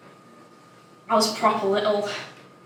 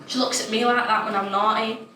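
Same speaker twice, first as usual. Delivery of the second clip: plain reading voice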